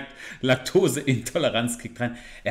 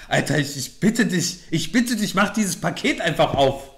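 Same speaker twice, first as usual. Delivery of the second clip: exasperated pleading; 4 semitones higher than usual. Real, not acted. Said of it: "Ich raste gleich aus".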